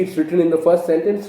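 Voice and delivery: normal talking voice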